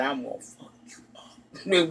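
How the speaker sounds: mock-hiss the threat like a strict old mama from the porch; stern mama whisper.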